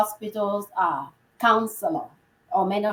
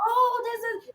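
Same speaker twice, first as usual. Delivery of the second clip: much higher and softer, a hammed-up fluster; mock flustered; 3 dB quieter than usual. Friend pretending to be flustered at meeting the husband. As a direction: play it caught off guard, faking it. Feigned awkward greeting.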